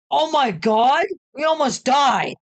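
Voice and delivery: high-pitched, rattled exclamation; shocked outcry. A narrator's reading. Friend reacts to the smashed chair, high shocked outcry. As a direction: cry it out after a near miss.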